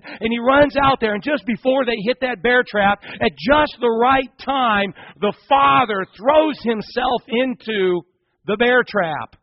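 high, forceful sermon storytelling; impassioned preaching. Real, not acted. Preacher telling of the father's sacrifice, impassioned. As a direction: build the climax, slowing for weight.

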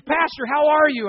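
acted-out delighted hello; eager greeting. Acting out a woman greeting him.

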